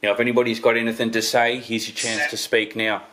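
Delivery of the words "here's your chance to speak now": ordinary talk, nothing marked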